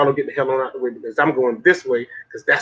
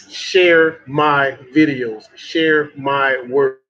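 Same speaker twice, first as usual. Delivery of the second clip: slow emphatic appeal; much slower than usual. Real, not acted.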